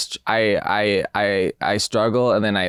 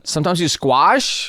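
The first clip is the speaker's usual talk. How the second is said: incredulous; 8 semitones higher, 3 dB louder than usual. Real, not acted. Incredulous echo.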